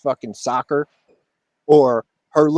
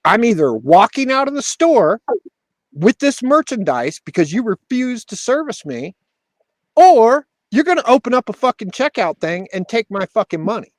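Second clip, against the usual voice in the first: retold ultimatum; 6 semitones higher, 4 dB louder than usual. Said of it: "I go".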